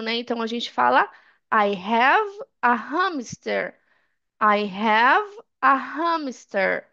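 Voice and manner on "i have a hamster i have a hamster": "I have a hamster" slowly, breathed H in both words; slow model, audible H in "have" and "hamster"; H sounded. Shown as a demonstration. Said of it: "esse H a gente tá pronunciando".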